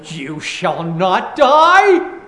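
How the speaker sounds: slow, drawn-out, a touch of a sly tempter; lightly voiced serpent, lingering on each word. Serpent contradicts God to woman.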